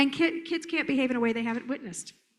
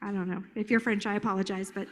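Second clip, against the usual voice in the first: normal talking voice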